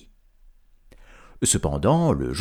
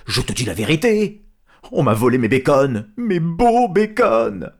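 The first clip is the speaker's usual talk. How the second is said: insistent, loud; 4 semitones higher, 6 dB louder than usual. Acted. Isengrin insists, louder.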